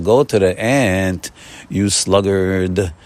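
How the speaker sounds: slow, weighty: go to the ant, you sluggard; slow emphatic quote. Shown as a demonstration. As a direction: stretch each word like scripture. Quoting Proverbs, slowly.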